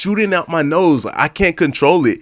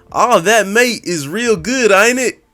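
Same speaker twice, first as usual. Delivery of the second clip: higher, slower, sweet Southern drawl; a hammed-up older Southern lady, pitched up; old Southern waitress; 9 semitones higher, 3 dB louder than usual. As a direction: ham up the old Southern lady checking the table. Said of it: "real older, real Southern lady".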